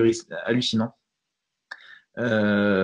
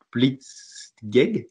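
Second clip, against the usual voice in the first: Blitzkrieg said with a German accent, a hint; a light put-on German accent on Blitzkrieg. Shown as a demonstration. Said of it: "Désolé pour mon accent allemand". (accent allemand léger sur Blitzkrieg)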